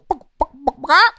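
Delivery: high imitated chicken clucks "buck"; chicken clucking. Shown as a demonstration. Demonstrating what a chicken really sounds like, high clucks. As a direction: cluck it like a hen, rising at the end. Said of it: "A chicken goes".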